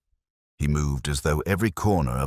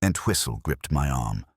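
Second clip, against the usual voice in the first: ordinary delivery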